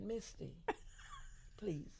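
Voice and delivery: soft, proper and breathy; delicate, polite request; prim little voice. Retold or imitated. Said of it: "I didn't talk like this at all".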